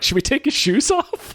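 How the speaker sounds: high-pitched mock suggestion, amused; mock question. Retelling a comic movie moment to a co-host, high amused mock question. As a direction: quote it high, on the edge of laughter. Retelling a funny panicked line.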